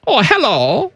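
put-on German accent. Host starting his Wolfgang bit, in a German accent. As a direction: greet them as a comic German character. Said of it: "This is my German accent".

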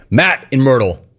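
normal talking voice

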